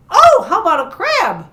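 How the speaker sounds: high, loud eager suggestion; sudden bright idea. Pretend. Presenter offers children another sea animal, excited. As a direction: burst out with a new idea.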